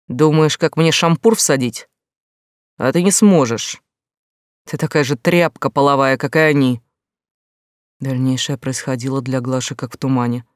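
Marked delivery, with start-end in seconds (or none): 0.1-6.8 злая насмешка, громче обычного; дави угрозой и презрением; угрожающе громко. Acted. Митя угрожает Глаше. (угрожающе, с презрением)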